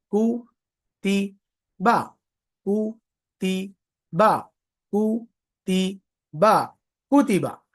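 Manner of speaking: कु ती बा spoken as separate syllables with pauses; slow syllable-by-syllable कुतीबा; split into syllables. Shown as a demonstration. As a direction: break कुतीबा into syllables for the class. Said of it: "split करो".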